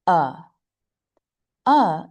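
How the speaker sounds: "uh" as a relaxed, neutral vowel; schwa sound. Shown as a demonstration. Teacher showing the second sound of A, schwa sound. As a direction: say "uh" from the throat with a loose jaw. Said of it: "is called the schwa sound".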